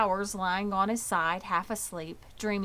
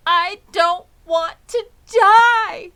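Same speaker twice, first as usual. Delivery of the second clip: high, very loud, slow scream; anguished scream; 11 semitones higher, 16 dB louder, slower than usual. Acted. Wilbur throws himself down, screaming high and very loud. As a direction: scream it out flat on the ground. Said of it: "screamed Wilbur".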